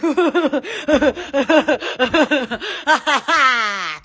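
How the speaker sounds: long helpless laugh; sustained, rolling laughter; laughing fit. Real, not acted. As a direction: keep laughing until it runs out. Long laughing fit after okay.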